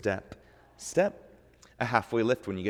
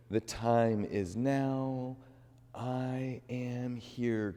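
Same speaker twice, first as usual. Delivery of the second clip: slow, drawn-out mantra in the stretch; 2 semitones higher, much slower than usual. Acted. Teacher repeating mantra during side stretch, slow and calm. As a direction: stretch the words out calmly.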